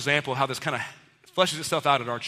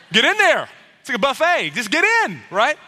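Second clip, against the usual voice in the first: high, loud buffet hype; hammed-up eager shout; mock excited urging; 8 semitones higher, 6 dB louder than usual. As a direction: shout them in like a buffet opened. Imagined Jesus urging a rush.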